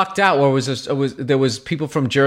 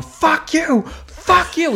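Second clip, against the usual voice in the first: high-pitched mock yell of a heckler; imitated yelling; much slower than usual. Angry kid heckling the comic from the front, imitated yelling. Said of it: "yelling at me".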